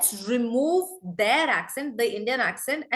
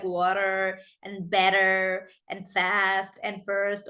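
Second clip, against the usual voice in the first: slow, drawn-out American accent demo; 3 semitones lower, much slower than usual. Shown as a demonstration. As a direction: stretch the words out in an American accent. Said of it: "to learn the American accent".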